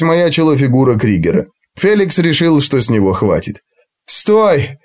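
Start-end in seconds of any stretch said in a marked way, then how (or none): 4.1-4.8 breathless shout. Acted. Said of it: "задыхаясь, прокричал он".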